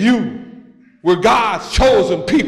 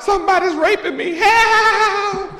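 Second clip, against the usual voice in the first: high fast cry for help; hollering wife; 13 semitones higher than usual. Pretend. Preacher voicing the wife crying for help, high and fast. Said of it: "My wife is hollering out".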